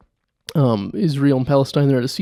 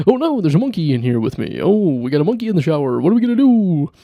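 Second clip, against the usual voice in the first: Robin Williams impression; 4 semitones higher, faster than usual. Podcaster doing Robin Williams finding a monkey, fast and frantic. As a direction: rattle it off in a manic panic. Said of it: "That's Robin Williams in a heartbeat".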